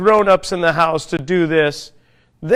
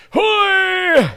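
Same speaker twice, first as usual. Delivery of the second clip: put-on high, loud old man's grunt of effort; grandpa's effort cry; 11 semitones higher, 3 dB louder than usual. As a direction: let out a high creaky old man's yelp. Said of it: "no reason for me to talk like that".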